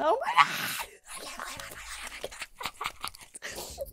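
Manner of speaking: high shriek of disbelief; shrieking oh my God; freaking out. Real, not acted. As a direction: scream with joy. Freaking out over Tom.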